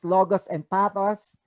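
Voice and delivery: ordinary talk, nothing marked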